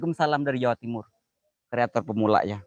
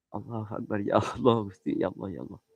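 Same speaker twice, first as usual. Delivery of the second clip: fading lament; 3 semitones lower, 5 dB quieter than usual. Real, not acted. Streamer scared on a haunted stream, fading murmur. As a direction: lower and softer, dying away.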